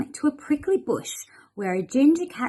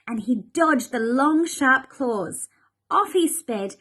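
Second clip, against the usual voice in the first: plain reading voice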